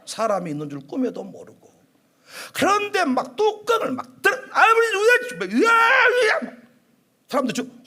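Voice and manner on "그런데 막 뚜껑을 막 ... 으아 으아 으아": long strained cry from below; trapped screaming. Acted. Trapped man screaming from the tank. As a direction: scream for help, stretched out.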